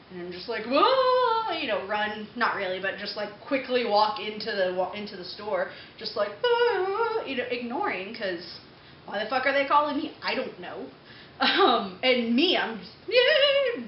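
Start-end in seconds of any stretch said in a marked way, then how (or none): 0.7-1.5 high, drawn-out mock "whoa"; exaggerated startled whoa. Retold startled reaction to being yelled at. 6.4-7.2 high, drawn-out "ah"; exaggerated little wail of avoidance; mock fleeing wail. Acting out hurrying away, ignoring them. 13.1-13.8 high, loud avoidance noise; exaggerated high-pitched recoil; mock recoiling squeal. Mock recoil from being called at.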